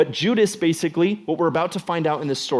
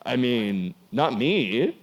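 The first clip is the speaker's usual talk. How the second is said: sheepish denial; 2 semitones lower, much slower than usual. Retold or imitated. Disciple at dinner denying betrayal, mock sheepish mumble. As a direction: play the guilty guest dodging blame. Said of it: "Everybody's at dinner like".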